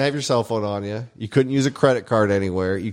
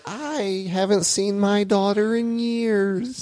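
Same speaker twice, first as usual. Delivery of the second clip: put-on sad old lady voice; 8 semitones higher, much slower than usual.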